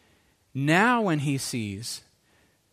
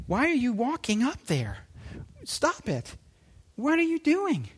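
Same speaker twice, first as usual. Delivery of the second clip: higher-pitched, baffled protest; scolding own feet; 7 semitones higher than usual. Retold or imitated. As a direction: plead with your own feet to stop, mock-alarmed. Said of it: "starts to have a conversation with his feet".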